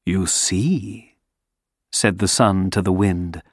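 warm beaming sun voice. Acted. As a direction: calmly claim the win. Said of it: "sun wins calmly".

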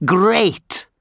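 high, happy burst; pleased wind. Acted. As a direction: relish it.

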